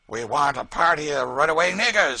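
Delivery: a loud, coarse bully; Tom Locker, coarse slave catcher. A narrator's reading. Tom to the posse, boastful bluster.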